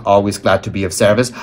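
ordinary delivery, nothing marked

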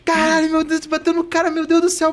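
high-pitched frantic wail; retold panic. Friend cries out as the cyclist is hit, high mock panic. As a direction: imitate a passenger panicking in one rush. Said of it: "E eu nervosíssimo".

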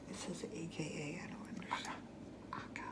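unvoiced whisper, barely audible. Real, not acted. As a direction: whisper it to yourself. Reading label while tasting quietly.

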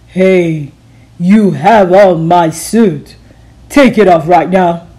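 low, loud, slow demand; gruff angry principal. Acted. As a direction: bark at her to hand it back.

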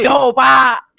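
hoger en luider uitroepen; loud call. Acted. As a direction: roep het over de straat. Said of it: "pas dia denger tuh".

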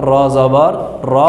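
slow র with rounded vowel, no aa; careful teacher's demo of র; correct pronunciation. Shown as a demonstration. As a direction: stretch র as the bare letter, not রা. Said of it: "শুধু রটাকেই উচ্চারণ করতে হবে".

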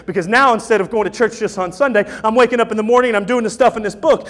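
usual speaking voice

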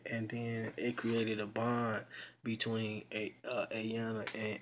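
normal talking voice

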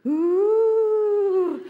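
high, long ghostly "ooh"; spooky music imitation. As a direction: hoot like haunted-house music. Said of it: "The music is".

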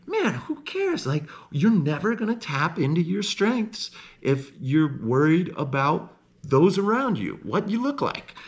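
high, loud fired-up encouragement; excited hype to yourself; pumped-up self pep talk. Retold or imitated. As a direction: psych yourself up, voice jumping high. Pep talk to himself in nature.

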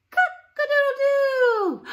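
loud, high, slow crowing; rooster crow. Shown as a demonstration. Teacher crowing like a rooster to the kids. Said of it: "the rooster says".

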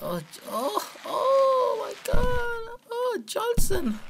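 high drawn-out hammed-up cry of grief; mock wailing. Player mourning ragdoll Johnson, high hammed-up wail. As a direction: overact sobbing over a fallen friend.

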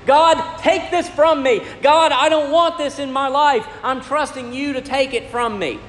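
high, pleading cry to God; Paul begging God in prayer, pleading and high. Retold or imitated. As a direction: beg God to take it away.

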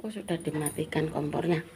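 usual speaking voice

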